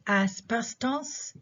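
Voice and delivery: asbestos slowly, stressed on BES; stress before consonant cluster. Shown as a demonstration. Teacher showing students the stress, asbestos hit on BES. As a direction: lean on the middle of asbestos. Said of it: "The stress is on this syllable".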